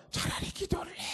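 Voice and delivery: high eerie whisper of a mocking demon; a demon whispering its scorn at the preacher; whispered demon taunt. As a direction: hiss it like a sneering spirit.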